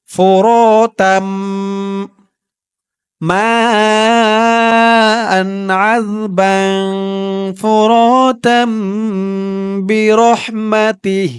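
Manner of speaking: tajweed recitation. Shown as a demonstration. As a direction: recite it slowly in a melodic chant. Recitation resumed. (ধীর সুরেলা তিলাওয়াত)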